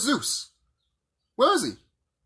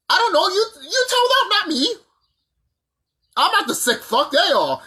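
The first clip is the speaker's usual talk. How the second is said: much higher and louder, heated outburst; worked up; 13 semitones higher, 4 dB louder than usual. Real, not acted. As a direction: pitch flies up as he gets riled. Worked-up rant about "them.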